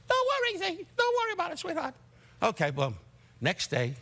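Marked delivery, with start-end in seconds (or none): 0.1-1.9 very high, light bird-like voice. Retold or imitated. Rickles imitates his old manager, very high bird voice. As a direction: squeak it up high like a tiny bird. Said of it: "he had a voice like a bird".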